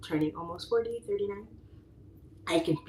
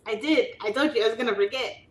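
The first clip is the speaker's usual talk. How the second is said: normal talking voice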